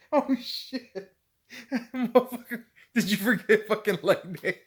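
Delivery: high, loud, through helpless laughter; laugh-choked mocking question; cracking up. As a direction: tease him while you can barely stop laughing. Quoting himself cracking up at friend.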